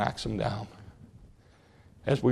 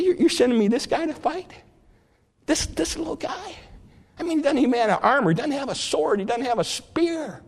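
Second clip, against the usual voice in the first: high, incredulous scoffing; hammed-up outraged giant; mock indignant giant; 10 semitones higher than usual. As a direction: sneer in disbelief at the little boy. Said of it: "he gets mad".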